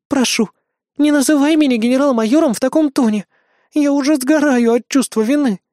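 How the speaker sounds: plaintive. Acted. Idol girl begs Mark not to sound so cold, plaintive. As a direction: beg him not to use that tone. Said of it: "жалобно отозвалась девочка-айдол".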